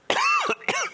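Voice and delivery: real cough from a cold; a sharp chesty cough. Real, not acted. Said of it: "I got the biggest tickle in my throat".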